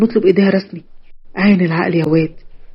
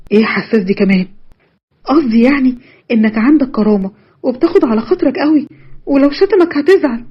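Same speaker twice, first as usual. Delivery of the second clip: put-on higher girl's voice, exasperated; a girl snapping back at her touchy boyfriend; Nada arguing; 6 semitones higher than usual. A narrator's reading. Nada argues back with Youssef.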